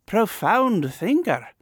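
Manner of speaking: slow, high-pitched acclaim; pompous reviewer. A narrator's reading. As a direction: pronounce it slowly, in a lofty high voice. Journals acclaim the writer.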